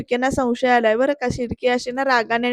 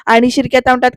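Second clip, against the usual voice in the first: ordinary delivery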